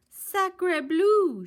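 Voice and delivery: high loud French-flavoured exclaim; duck crying out. Acted. Duck waddles up to the nest, loud high cry. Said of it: "she cried".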